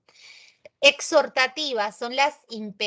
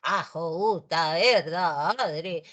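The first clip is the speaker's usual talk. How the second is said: Cachín catchphrases; 5 semitones lower, slower than usual. Retold or imitated. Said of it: "Él siempre andaba con su".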